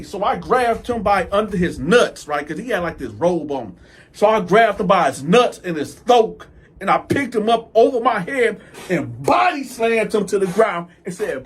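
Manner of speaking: usual speaking voice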